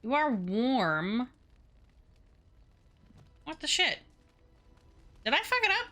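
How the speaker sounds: normal talking voice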